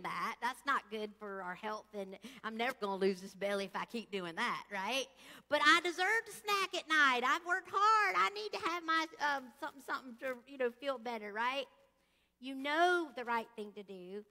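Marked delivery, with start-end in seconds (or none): 5.5-11.3 self-justifying. Retold or imitated. Voicing the snacking excuse.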